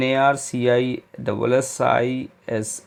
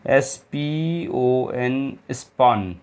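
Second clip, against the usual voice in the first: letters spelled out slowly one by one; slow dictation to students; slow spelling; much slower than usual. Shown as a demonstration. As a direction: dictate each letter with long gaps. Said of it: "अब देख लीजिए कौन सी spelling होनी चाहिए".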